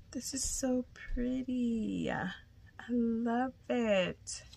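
high, slow whisper; admiring whisper. Real, not acted. Admiring the little charm, whispered. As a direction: whisper it, slowly, in awe.

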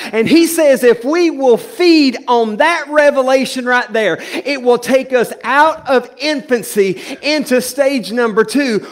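loud, impassioned sermon delivery; full preaching. Acted.

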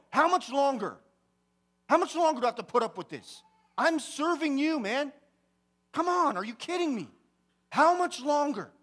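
high, loud, exasperated outburst; retold yelling at God. Preacher re-enacts pouring his heart out to God, yelling in frustration. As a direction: shout it upward in furious protest. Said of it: "yelling at God".

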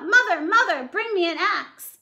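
high, loud, slowed urgent call; loud urgent Jack call; calling out urgently. Acted. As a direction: shout for help while fleeing. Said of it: "Jack called out".

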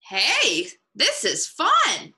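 high, drawn-out happy discovery; excited little bird; delighted. A narrator's reading. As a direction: stretch it out with rising delight.

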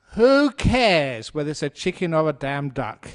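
loud, emphatic rhetorical question; exasperated outburst. Real, not acted. Monk asking listeners if being right matters, loud exasperation. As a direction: burst out with who cares.

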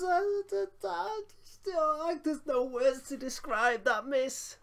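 high, slow, stammering disbelief; can't get the words out after the miss; stunned disbelief. Real, not acted. Watching his striker miss, stammering in disbelief. Striker misses open goal.

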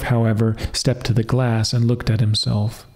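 plain reading voice

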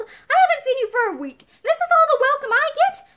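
loud, high-pitched indignant complaint; aggrieved grumble. A narrator's reading. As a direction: protest loudly to your wife. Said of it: "he grumbled".

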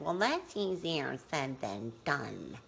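skeptical inner voice. Retold or imitated. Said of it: "something in your head said".

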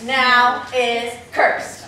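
"Now is cursed" very slow, word by word; slow correction. Shown as a demonstration. Director fixing kids' word order, "Now is cursed" slowly spaced.

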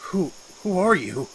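high, stammering scared question; frightened stammer. Acted. Man startled by a woman beside him, stammering. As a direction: stumble over the words in fright.

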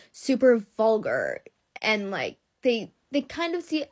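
normal talking voice

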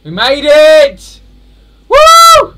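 very high, very loud drawn-out victory scream; ecstatic shout. Real, not acted. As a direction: scream with joy at finally making it. Finally clearing the jump.

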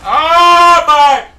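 loud, high cry of real frustration; pissed-off yell. Real, not acted. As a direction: explode at the game. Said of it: "It's starting to piss me off".

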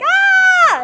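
very high, loud happy shriek; delighted scream. Retold or imitated. Greeting the director on set, loud joyful shriek. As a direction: shriek with joy. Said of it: "I'm like".

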